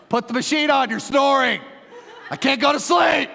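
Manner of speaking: high, loud complaining shout, laughing; mock exasperated yell; annoyed wife. Pastor imitating his wife yelling about the snoring. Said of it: "She's like".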